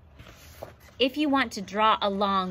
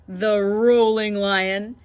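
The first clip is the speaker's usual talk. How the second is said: playful stretch call; 9 dB louder, much slower than usual. Acted. Acting out stretch names.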